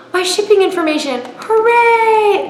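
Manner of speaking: high, loud squeal of joy; mock delight. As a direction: shriek it like a kid on Christmas. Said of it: "and I'm like".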